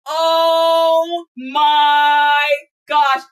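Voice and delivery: normal talking voice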